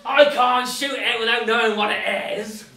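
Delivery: very loud, slow, drawn-out protest; acted exasperation. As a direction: holler it out stubbornly, stretching the words. Hans's stubborn climactic refusal.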